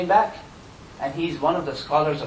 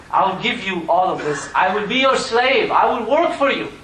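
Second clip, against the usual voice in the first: rising, louder desperate plea; pleading; 5 semitones higher, 6 dB louder than usual. Retold or imitated. Sinner begging his victim, rising pleading voice. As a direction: beg him to take everything.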